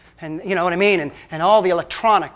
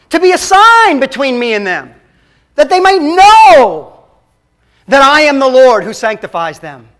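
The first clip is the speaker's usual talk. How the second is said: louder, solemn reading of God's words; voicing God through Ezekiel; 5 dB louder than usual. Pastor reads God's words from Ezekiel, solemn and loud. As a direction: proclaim it as the voice of the Lord. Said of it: "Listen to God through Ezekiel".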